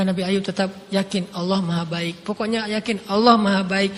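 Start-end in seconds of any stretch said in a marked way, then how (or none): none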